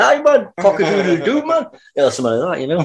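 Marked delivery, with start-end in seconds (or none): 0.0-1.7 high-pitched crowing in Geordie. Acted. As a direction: crow like a rooster from Newcastle, Geordie accent. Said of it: "you mean like a Geordie crow?".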